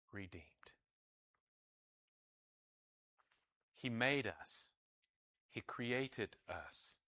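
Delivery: soft, low, measured preaching; solemn preaching. Real, not acted. Preacher telling congregation they are redeemed, solemn hush. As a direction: bring it down to a hush, phrase by phrase.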